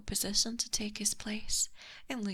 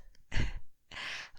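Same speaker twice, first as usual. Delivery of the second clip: teasing breathy laugh; 4 dB louder than usual. Acted. Teasing the prince.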